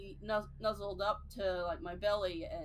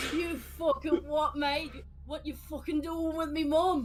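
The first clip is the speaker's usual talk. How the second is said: Aussie bogan; 7 semitones higher, 4 dB louder than usual. Pretend. Mock Aussie bogan threat.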